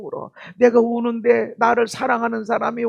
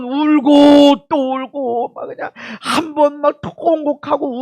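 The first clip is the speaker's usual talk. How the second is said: wailing lament; 6 semitones higher, 7 dB louder, slower than usual. Shown as a demonstration. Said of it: "통곡했다라는 것은".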